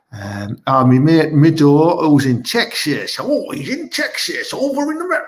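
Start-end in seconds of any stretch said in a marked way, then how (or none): 3.2-5.2 high-pitched put-on American accent. Shown as a demonstration. Live show host mimicking his mate in Texas, American accent. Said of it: "That's my American accent there".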